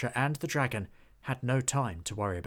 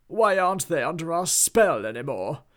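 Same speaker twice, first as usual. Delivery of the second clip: loud, high, frantic demand; angry demand; 6 semitones higher, 8 dB louder than usual. A narrator's reading. Duke shouts at his cats, demanding loudly. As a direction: bark the question in panic.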